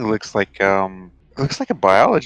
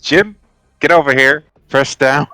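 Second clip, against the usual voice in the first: raised, higher calling voice; in-character yell; 5 semitones higher, 6 dB louder than usual. Acted. Player's character summoning Jim to push the lid, yelled orders. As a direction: call Jim over urgently. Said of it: "She'll probably yell".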